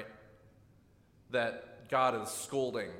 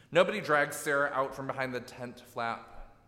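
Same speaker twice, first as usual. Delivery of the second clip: ordinary delivery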